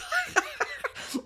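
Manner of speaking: sudden high-pitched burst of laughter; real laugh breaking out. Real, not acted. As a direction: laugh out at the memory. Laughs at his own story.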